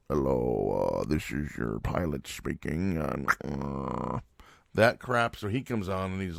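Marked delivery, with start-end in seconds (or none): 0.1-4.2 low, slow airline captain intercom drawl; pilot voice. Retold or imitated. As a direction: announce it like a bored airline captain. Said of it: "you know that pilot voice?".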